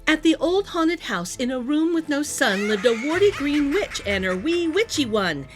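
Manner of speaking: ordinary delivery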